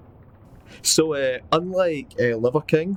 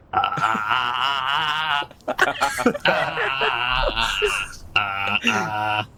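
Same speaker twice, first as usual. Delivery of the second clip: drawn-out sung "ah" warm-up notes; mock vocal exercise on open "ah"s; much slower than usual. Player preparing for a Cockney accent, vocal warm-up ahs. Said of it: "gotta do my warm-ups".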